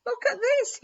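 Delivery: put-on high, loud excited child's call; children yelling. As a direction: shout it out across the field to Dad. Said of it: "yelled the children".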